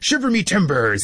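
high, swooping pirate exclamation; hammed-up pirate for a story reading; pirate Backbeard. Voices pirate in children's book.

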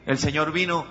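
loud, ringing proclamation; fervent loud sermon climax; preaching at full force. Acted. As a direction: bring the sermon to its fiery peak. Climactic loud healing exhortation.

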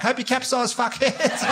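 high, sing-song wave call with a sweet fake smile; cheery insult. To passing boaters, a mock cheerful high call. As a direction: shout a friendly-sounding insult across the water. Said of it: "You're just like".

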